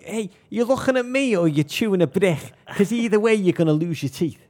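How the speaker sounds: high, loud Scouse schoolyard challenge; mock-aggressive Liverpool schoolboy; Scouse fronting. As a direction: front up to them in thick Scouse. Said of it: "Like, full on fronting it".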